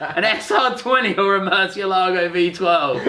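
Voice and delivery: high, drawn-out mock video pitch; mock presenter. Pitching a video series to a friend as a joke, mock presenter. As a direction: stretch it out, high and laughing.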